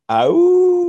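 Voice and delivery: high, loud long howl; little bunny imitating the wolf's long howl. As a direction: howl like a wolf at the moon. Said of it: "Ruthie made this sound".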